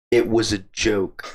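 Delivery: slow deadpan line. Retold or imitated. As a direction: drag it out flatly to the cop.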